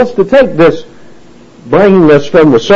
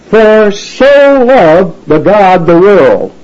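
slow, measured word-by-word reading; literal reading; 4 semitones higher, much slower than usual. Shown as a demonstration. Preacher reading the Greek word order literally to listeners, slow and deliberate. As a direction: read it out deliberately, each word set apart. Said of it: "the Greek text reads this way".

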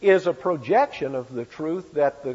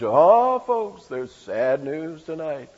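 radio newsman voice; much slower than usual. Retold or imitated. Preacher imagining Ezekiel as a radio announcer. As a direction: announce the sad news like an old broadcaster. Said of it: "the way Ezekiel would come on".

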